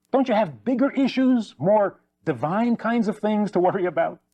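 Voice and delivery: acted exasperation, high-pitched and pleading; exasperated plea. Believer tells God to grow up, exasperated. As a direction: reason with God in mock exasperation.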